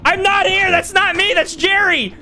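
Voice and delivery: high, exasperated shouts of denial; frantic yelling. Real, not acted. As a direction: yell in protest as you're found. Caught player yells frantic denials.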